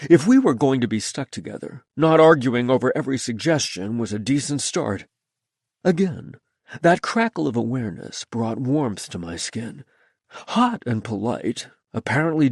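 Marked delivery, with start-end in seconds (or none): none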